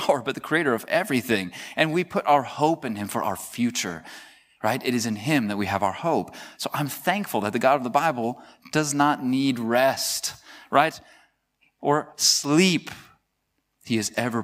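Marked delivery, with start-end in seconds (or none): none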